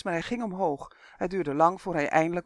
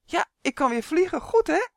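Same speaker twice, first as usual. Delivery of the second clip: high, loud, delighted elf; acted elated shout; beaming; 11 semitones higher, 6 dB louder than usual. A narrator's reading. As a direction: crow with joy, pitch climbing sharply at the end. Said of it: "riep hij stralend".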